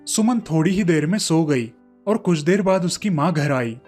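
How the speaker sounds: ordinary delivery, nothing marked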